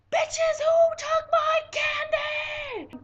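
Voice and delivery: drawn-out, very high furious screech; freaking-out scream. Retold or imitated. Yelling out the door at candy thieves, outraged high scream. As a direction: scream it after the thieves, stretching every word. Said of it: "I'm freaking out".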